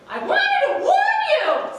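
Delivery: very high, loud, slow bird voice with a laugh; high screechy albatross. A narrator's reading. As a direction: screech a warning. Albatross voice in story.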